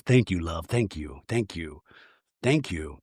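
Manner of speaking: low, soft murmured gratitude; hushed thanks. Retold or imitated. Mary accepting her gift, low and hushed. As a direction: imitate someone softly repeating thanks in a low voice.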